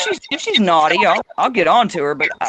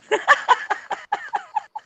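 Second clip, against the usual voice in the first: soft, very high laughter; real laughing fit tailing into squeaks; helpless laughter; 5 dB quieter than usual. Real, not acted. Still cracking up over hairballs, soft high-pitched laughter.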